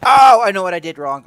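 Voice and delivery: loud, high burst of realization; sudden realization. Real, not acted. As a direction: blurt it out as it clicks.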